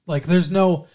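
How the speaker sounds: ordinary delivery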